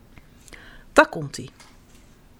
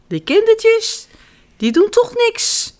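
high, slow bird voice; dismissive birds; 13 semitones higher, slower than usual. A narrator's reading. Birds scoffing at harmless children, dismissive. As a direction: scoff in a high-pitched, leisurely voice.

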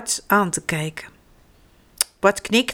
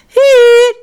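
put-on high, loud drawn-out child's shout; calling out; 12 dB louder than usual. A narrator's reading. Bart answers mama from across the shop, calling out high. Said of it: "roept Bart".

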